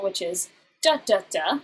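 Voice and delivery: da da da, first beat stressed; dactyl rhythm. Shown as a demonstration. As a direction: tap out the dactyl, strong then weak weak. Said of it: "the dactyl".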